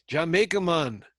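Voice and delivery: hammed-up Jamaican accent. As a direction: lean into the Jamaican lilt on "Jamaica". Repeats the slogan in accent.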